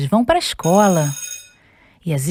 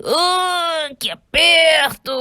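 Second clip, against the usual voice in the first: squeezed yelp; 10 semitones higher, much slower than usual. Acted. Squeezed by Shirley.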